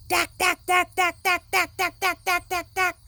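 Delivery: high, loud, rapid dack; jackhammer. A narrator's reading. Reading to children, loud high jackhammer. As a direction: hammer it out loud. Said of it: "It makes a loud banging sound".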